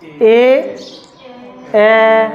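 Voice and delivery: ए and ओ held the same length each time; equal length vowels. Shown as a demonstration. Teacher asks students to hear equal timing. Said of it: "time कम ज़्यादा".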